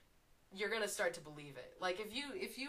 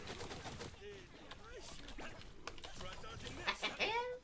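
long, high, softer giggle; giddy laughter; 9 semitones higher, 8 dB quieter than usual. Real, not acted. Streamer amused at own quip, long high giggle. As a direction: giggle away helplessly.

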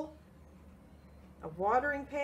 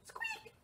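tiny high squeak; very high, soft mouse squeak; 11 dB quieter than usual. Pretend. Mouse in the story.

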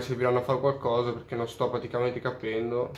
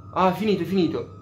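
higher, louder burst of relief; excited realization; 5 semitones higher, 3 dB louder than usual. Real, not acted. Player seeing the mission suddenly end, excited realization. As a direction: exclaim it as it dawns on you.

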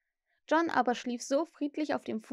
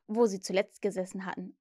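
ordinary delivery, nothing marked

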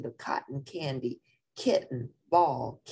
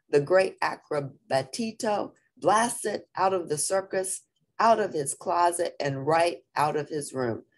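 usual speaking voice

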